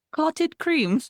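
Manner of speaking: high, loud mock British accent. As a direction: put on a hammed-up British accent. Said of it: "say it in a British accent".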